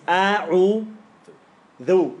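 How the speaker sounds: A'u, then a clear voiced th in "dhu"; dhal pronunciation. Shown as a demonstration. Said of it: "if you wanna say dhal".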